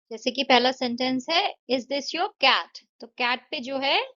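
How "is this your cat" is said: Is this your cat? rising on cat; slow model question lifting on cat; rising tune. Shown as a demonstration. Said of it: "rising tone होगी।".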